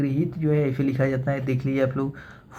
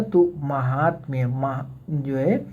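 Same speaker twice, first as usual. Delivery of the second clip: slow spelling demo; slower than usual. Shown as a demonstration. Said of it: "ये शुद्ध होता है महात्म्य".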